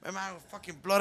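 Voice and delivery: usual speaking voice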